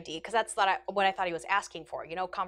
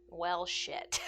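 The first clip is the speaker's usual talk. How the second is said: muttered dread; 3 semitones lower, 8 dB quieter than usual. Retold or imitated. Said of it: "And I was like".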